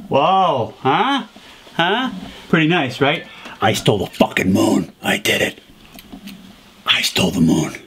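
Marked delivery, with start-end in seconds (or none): 3.6-5.6 low, gloating villain voice; deep villain boast. Acted. 6.9-7.8 deep villain boast. Acted. Villain repeats boast lower.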